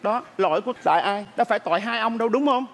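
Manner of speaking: ordinary talk, nothing marked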